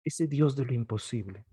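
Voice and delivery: usual speaking voice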